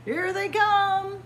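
usual speaking voice

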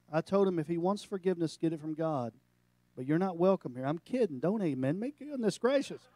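ordinary delivery

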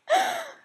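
a high, loud sudden gasp; sharp gasp. Real, not acted. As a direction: gasp as if struck by a thought. Gasps remembering her planner.

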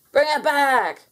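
a blobby calling after the borrowed spaceship. Acted. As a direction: shout for the spaceship back. Friend takes spaceship without asking.

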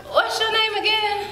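put-on high girlish question; a young girl asking again; little girl voice. Girl asks again, surprised.

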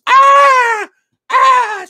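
very high, loud imitated shriek; two startled screams, acted full out; frightened scream. Nurse scared as the patient moves, imitated loud scream. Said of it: "It scared her really bad".

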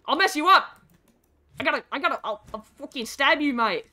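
loud mock-threat in Australian accent. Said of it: "I don't know why I'm Australian".

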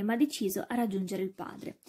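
ordinary delivery

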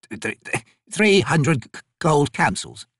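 stuttering, hesitant price; nervous stammer. Acted. Squirming trader names his price, stammering nervously. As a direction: trip over the first sounds, then blurt it.

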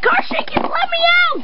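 high shrieking terror; panicked scream. Acted. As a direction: scream it, trapped and scared. Girl trapped in haunted car.

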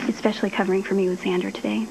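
normal talking voice

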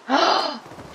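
surprised gasp. Real, not acted. Surprised gasp before nonsense chant.

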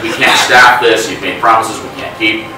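ordinary delivery

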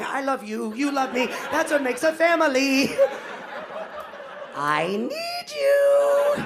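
rising to a high, slow squeal of love; baby talk to cat. Acted. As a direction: coo at the cat as you smoosh its face. Voicing himself squishing cat.

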